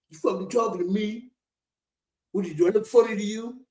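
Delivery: menacing gangster movie lines; quoting tough-guy film lines, half playful; tough-guy movie quotes. Retold or imitated. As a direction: do the classic mob movie threats. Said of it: "Joe Pesci".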